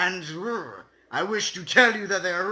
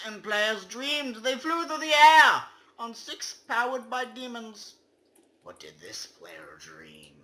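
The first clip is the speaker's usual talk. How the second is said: usual speaking voice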